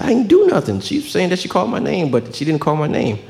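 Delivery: put-on a small boy protesting his innocence, voice pitched high; indignant kid. Child protesting to his dad.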